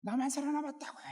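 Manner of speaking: high, strained cry of bitter protest; hammed-up defiant complaint, neck veins bulging; indignant Elijah. Elijah protesting to God that he alone survived, straining high in indignant appeal. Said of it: "목에 핏대를 세우고 대드는".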